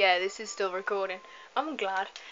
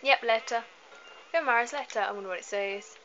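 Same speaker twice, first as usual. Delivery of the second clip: ordinary delivery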